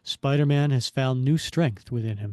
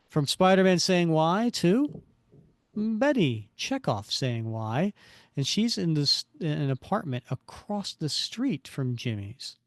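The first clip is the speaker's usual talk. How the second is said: ordinary delivery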